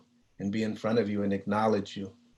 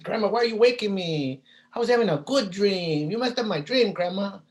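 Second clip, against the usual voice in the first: put-on much higher, complaining kid voice; whiny child; 9 semitones higher, 3 dB louder than usual. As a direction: whine like a sleepy kid woken too early. Child woken early by grandma.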